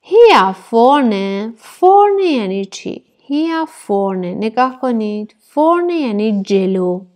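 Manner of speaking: ordinary talk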